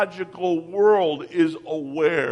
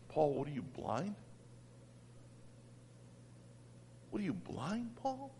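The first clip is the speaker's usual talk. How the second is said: much softer, hushed voice of God; soft gentle rebuke; 3 semitones lower, 22 dB quieter than usual. Retold or imitated. God quietly chiding Paul, very soft rebuke. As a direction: chide him almost in a whisper. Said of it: "I can hear God so often saying".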